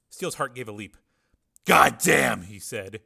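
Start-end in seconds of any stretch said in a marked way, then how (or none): 1.6-2.4 pleased surprise. A narrator's reading. Hero surprised by the name.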